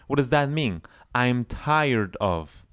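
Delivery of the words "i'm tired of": slow, drawn-out model phrase; slow drill phrase. Shown as a demonstration. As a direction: say it slowly for students to copy. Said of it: "like it would be like one word".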